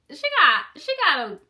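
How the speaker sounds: loud, high excited stammer with a laugh; excited shriek. Real, not acted. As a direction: blurt it out thrilled. Friends react to engagement ring.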